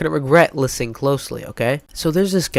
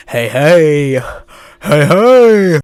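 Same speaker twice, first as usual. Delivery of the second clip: imitated yelling; 3 semitones higher, 11 dB louder, much slower than usual. Kid yelling hey at the narrator over and over, loud mock yell. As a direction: bellow it at the neighbour kid. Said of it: "he just starts yelling".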